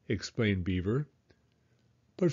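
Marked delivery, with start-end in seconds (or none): none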